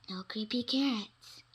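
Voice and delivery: ordinary delivery, nothing marked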